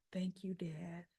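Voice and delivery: near-whispered, soft thanks; hushed gratitude. Retold or imitated. Danny looking up to thank his dad, hushed. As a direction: thank him tenderly under your breath.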